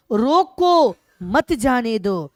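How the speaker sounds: रोको loud and stretched, then a break before मत जाने दो; pause after रोको. Shown as a demonstration. Teacher reading out the comma's meaning, pause after रोको. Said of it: "अर्थात अर्ध विराम दिया".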